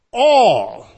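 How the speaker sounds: a loud, drawn-out All; emphatic all. Acted. Preacher to congregation, All called out loud. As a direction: call out All as the key word. Preacher hitting the first Greek word.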